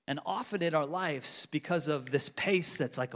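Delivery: ordinary delivery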